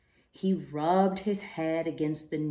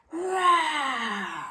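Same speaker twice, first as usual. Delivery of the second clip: loud, higher lion roar; 9 semitones higher, 5 dB louder than usual. Acted. Lion roars at closed books, loud roar. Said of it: "he roared very loud".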